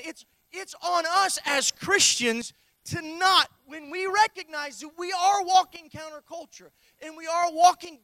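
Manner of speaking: loud shouted sermon, driving; preaching at full force. Acted. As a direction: shout it to the congregation.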